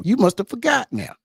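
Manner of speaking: high-pitched borrowed catchphrase; Roy Jones quote. Retold or imitated. Repeating Roy Jones's old line to listeners, teasing callback. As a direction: throw the boxer's line out high. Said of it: "As Roy Jones said years ago".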